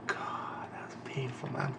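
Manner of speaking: pained whisper. Real, not acted. Reacting to sad manga scene.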